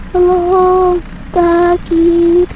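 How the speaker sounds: high, pained plea for help; faint victim voice. Acted. Injured victim begs the driver, high and pained.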